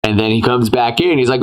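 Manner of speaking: ordinary talk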